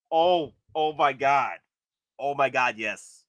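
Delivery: ordinary delivery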